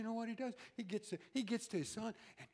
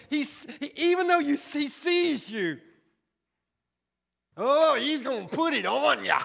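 loud preacher's rise; loud impassioned sermon; preaching at full force; 5 semitones higher, 16 dB louder than usual. Acted. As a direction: preach it to the back pews.

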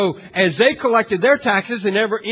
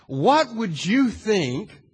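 usual speaking voice

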